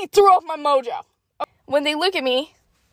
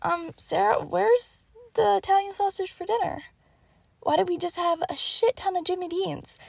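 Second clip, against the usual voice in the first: imitating mom; 5 semitones higher, 6 dB quieter than usual. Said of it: "otherwise she'd be like".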